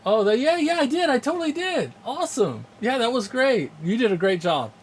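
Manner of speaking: high-pitched real excitement, settling slightly at the end; excited. Real, not acted. Streamer replying to a viewer about a great wedding, excited. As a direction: gush in a high, lively voice.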